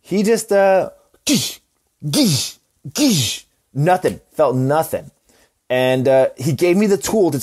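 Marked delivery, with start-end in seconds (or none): 1.2-3.4 three high, punchy stapler bursts; imitated stapler noise. Patient shows the doctor stapling his head, punchy imitated noise. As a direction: make the stapler sound, shot by shot.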